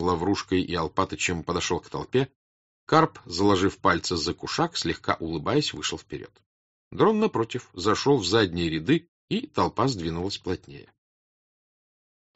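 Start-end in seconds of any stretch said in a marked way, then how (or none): none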